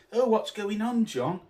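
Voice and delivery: mocking sycophant. The Gad greeting John, in a high fawning mock voice. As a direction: simper it like a crawling hanger-on. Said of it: "he was".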